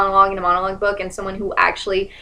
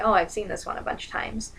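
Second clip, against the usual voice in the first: soft, mildly bored imitation of a director; lightly mocking, uninterested aside; zoned-out director; 10 dB quieter than usual. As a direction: murmur it like you've tuned out. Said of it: "he or she is gonna kinda zone out".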